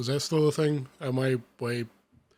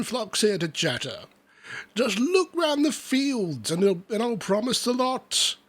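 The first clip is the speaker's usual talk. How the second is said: farmer's voice; 6 semitones higher than usual. Acted. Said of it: "said the farmer".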